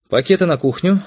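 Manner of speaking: high-pitched wifely command; brisk domestic order. A narrator's reading. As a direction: order them about like a wife in charge. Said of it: "скомандовала Светлана".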